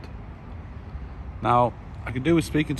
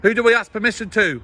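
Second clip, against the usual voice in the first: raised voice, calling across distance; shouting over machinery; 10 semitones higher, 4 dB louder than usual. Real, not acted. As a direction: shout so he can hear you. Shouted exchange across camp fence.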